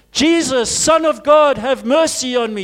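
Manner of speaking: crying out. Retold or imitated. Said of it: "They said".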